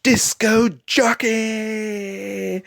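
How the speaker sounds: loud, high, long-drawn winner announcement; Simon Cowell. Retold or imitated. Dad as judge revealing the winner, big drawn-out shout. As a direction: announce the champion at the top of the voice. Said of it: "and he says".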